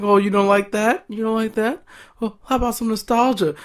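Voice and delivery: high, mocking taunt. Reviewer voicing Ziggler taunting the fans, high. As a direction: tease the crowd in a high voice. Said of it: "He's like".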